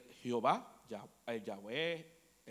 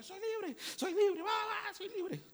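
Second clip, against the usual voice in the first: shouting for joy; slower than usual. Retold or imitated. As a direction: yell it out like a freed slave. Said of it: "Empezó a gritar". (grito eufórico y agudo de libertad)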